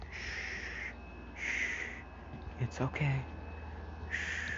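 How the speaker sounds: very soft, soothing shushes; Aizawa hushing. Retold or imitated. Teacher holds a crying student, softly hushing. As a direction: hush and comfort, barely voiced.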